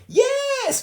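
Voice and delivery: put-on very high, loud toddler yes; toddler shouting back. A narrator's reading. Fudge shouting back at Mum, high loud toddler yes. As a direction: yell it back like a gleeful toddler. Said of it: "Fudge shouted back".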